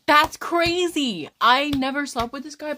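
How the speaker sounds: high, loud outburst of disbelief; incredulous exclamation. Real, not acted. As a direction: cry it out, slow and drawn-out at first. Exclaims at the texts' craziness.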